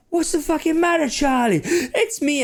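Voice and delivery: high-pitched, indignant character voice; somebody calling out. Acted. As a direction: exclaim it high, as a rowdy acquaintance. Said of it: "you can hear somebody go".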